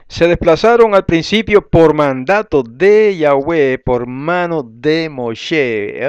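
deliberate, ringing reading aloud; scripture reading. Real, not acted. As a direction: proclaim the verse, stretching key words. Reads the verse aloud.

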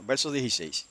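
usual speaking voice

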